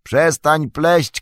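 high, slow, irritated call; drawn-out exasperated yell; shouting. A narrator's reading. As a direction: shout up to cut off the rambling. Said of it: "krzyknął Frodo".

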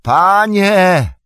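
high, loud drawn-out shout; long, high-pitched yell into the wind; desperate call. A narrator's reading. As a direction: call out for your master over a storm. Calling out to lost master in storm.

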